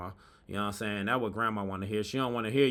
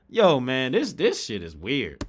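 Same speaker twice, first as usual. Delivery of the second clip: ordinary talk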